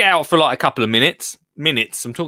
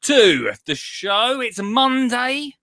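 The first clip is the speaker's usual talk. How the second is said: cheery host greeting; 8 semitones higher than usual. Acted. Presenter opening the Monday garden show with a cheery welcome. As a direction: welcome the viewers warmly to the show.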